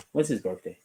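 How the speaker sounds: ordinary delivery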